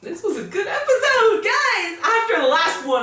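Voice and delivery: high, giddy excitement; excited verdict. Real, not acted. Telling viewers it was a good episode, excited. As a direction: burst out high, thrilled with what you watched.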